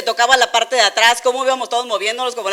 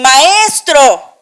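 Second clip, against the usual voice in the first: Maestro yelled much louder, a touch higher; panicked shout; 4 semitones higher, 9 dB louder than usual. Retold or imitated. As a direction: scream for the master over the storm.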